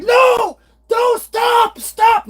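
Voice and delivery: very high, loud cries of stop; mock frantic shout. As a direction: yell it like a frightened old man. Imitating father refusing at bank.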